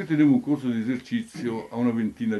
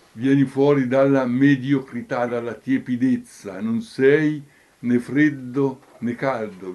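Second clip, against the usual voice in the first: exhortatory preaching; 3 semitones higher, 6 dB louder than usual. Real, not acted. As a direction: preach it as an urgent summons.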